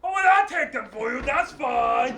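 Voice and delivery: plain reading voice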